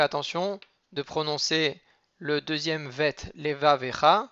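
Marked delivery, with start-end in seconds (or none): 3.3-4.3 Leva Vecha with a clear break and a spoken e after the v; voiced sheva. Shown as a demonstration. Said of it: "avec un Sheva Na".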